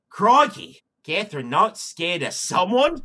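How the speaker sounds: mock Australian accent, loud; hammed-up Australian bloke. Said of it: "my best Australian accent".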